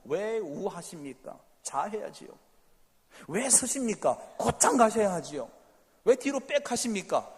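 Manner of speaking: building from slow to loud challenging; mock complaining. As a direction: argue back like a nitpicker. Acting a quarrelsome complainer. (따지듯 점점 목소리를 높이며)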